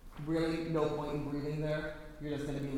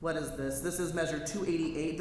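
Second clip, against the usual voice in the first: normal talking voice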